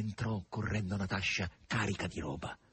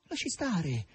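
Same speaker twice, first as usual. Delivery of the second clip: higher, insistent call to stop; impatient cry; 10 semitones higher, 3 dB louder, slower than usual. A narrator's reading. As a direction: cry out to wave it away. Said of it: "gridò Margherita".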